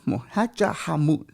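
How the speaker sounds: "Hakja Hamut" said slowly, syllable by syllable; a slow, deliberate "Hakja Hamut", no put-on accent; careful respectful pronunciation. Real, not acted. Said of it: "I'm just trying to pronounce it with respect".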